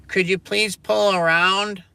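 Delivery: very high, loud, slow sing-song; mock drive-thru clerk. Mocking the clerk at the McDonald's window, high sing-song. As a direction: squeak it like a fake-polite cashier.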